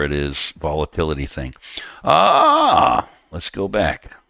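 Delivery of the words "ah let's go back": put-on high old-timer drawl on the first word. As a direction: play a crusty old timer. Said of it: "messing up with you on the old timer voice".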